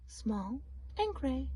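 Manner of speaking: ordinary delivery, nothing marked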